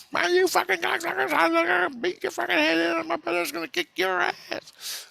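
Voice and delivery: put-on very high, squealing kid on a rant; mock yelling kid. Storyteller mimics kid who found fort trashed, high mock yelling. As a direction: imitate an enraged kid in falsetto. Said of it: "He's yelling".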